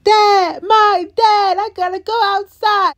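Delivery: high mock-panicked wail; freaking out. Julie trying to go out the door, mock freaking out. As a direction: imitate a girl frantic for her father. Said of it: "is over there freaking out".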